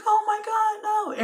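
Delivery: high, slow wail of dismay; imitated shock. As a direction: mimic a grieving crowd, drawn out. Imitates everybody reacting to death.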